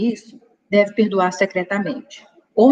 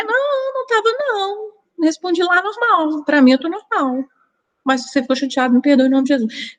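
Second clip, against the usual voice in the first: "Como vai?" pitched higher, with an emotional edge; higher-toned reading; 9 semitones higher than usual. Retold or imitated. Reading the same message in a charged mood, higher-pitched. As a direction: read it as if already upset.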